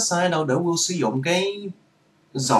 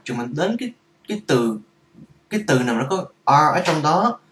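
normal talking voice

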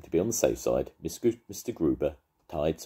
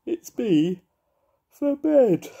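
very high, loud little-bear voice; sleepy bear; 8 dB louder, much slower than usual. A narrator's reading. Paddington answering Mister Gruber at bedtime, high cute bear voice. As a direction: squeak it out, tucked up. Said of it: "said Padding sleepily".

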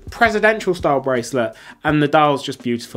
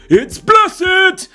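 Brian Blessed shout; 7 dB louder than usual. Retold or imitated. Repeats Brian Blessed gag.

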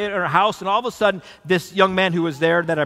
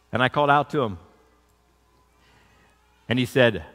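ordinary talk, nothing marked

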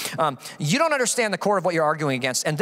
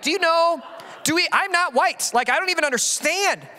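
higher, baffled protest; higher, disbelieving protest; incredulous retort; 9 semitones higher than usual. Retold or imitated.

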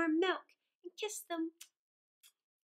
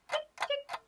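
clock ticking; 13 semitones higher than usual. Acted.

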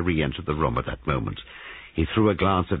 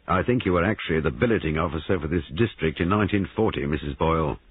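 usual speaking voice

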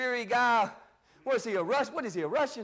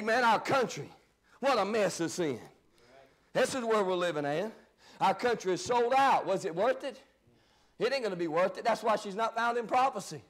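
ordinary delivery

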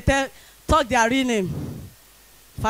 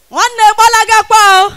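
higher and much louder, calling to the crowd; 7 semitones higher, 10 dB louder than usual. Acted. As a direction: belt it out to the back row.

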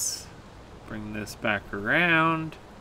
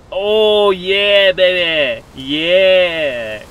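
loud, high cheering; triumphant; 5 semitones higher, 8 dB louder, much slower than usual. Real, not acted. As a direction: celebrate the climb. Climbing success.

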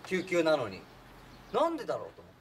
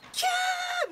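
high shrill imitated scream of the girl; 女の子の悲鳴を真似て; mock scream; 4 dB louder, much slower than usual. 悲鳴の再現.

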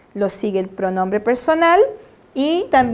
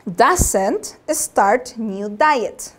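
doesn't start new diet drawn out very slowly; slow contracted form; 2 semitones lower, much slower than usual. Shown as a demonstration. Teacher showing students the contraction, very slow. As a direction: model the contraction at dictation speed.

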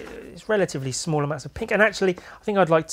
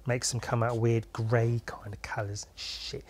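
whisper; 3 semitones lower, 7 dB quieter than usual. Real, not acted. Grumbling about smudging.

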